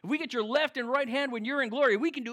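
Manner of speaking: youthful enthusiasm. Retold or imitated. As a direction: gush like eager young disciples. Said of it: "this youthful enthusiasm comes forward".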